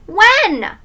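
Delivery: put-on high, loud little-kid question; impatient child's high query; eager demand. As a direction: demand to know right now. Child demands to know when.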